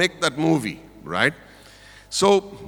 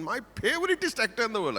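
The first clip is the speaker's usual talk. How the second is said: gushing fan; 8 semitones higher than usual. Retold or imitated. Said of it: "He went on and on".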